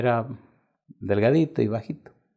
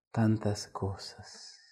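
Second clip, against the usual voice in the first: wistful echo; 7 dB quieter, much slower than usual. Real, not acted. Reflective repetition.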